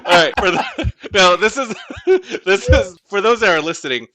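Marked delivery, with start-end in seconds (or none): 0.1-3.0 laughing loudly through the words; can't stop laughing while talking. Real, not acted. Laughing while introducing the meme.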